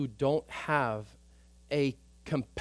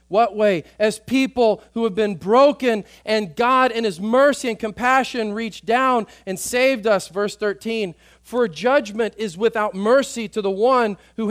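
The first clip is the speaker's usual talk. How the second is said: louder, higher preaching at full force; fervent preaching; 7 semitones higher, 11 dB louder than usual. Acted. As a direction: drive it home to the congregation.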